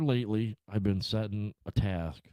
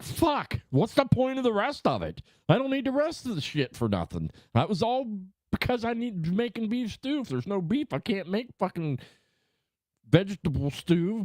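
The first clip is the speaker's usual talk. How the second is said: high-pitched fed-up complaint, much higher; exasperated rant; 13 semitones higher than usual. Retold or imitated. Retelling an order with no beef, high exasperated rant. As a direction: squeal out the frustration at the missing beef. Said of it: "you're like".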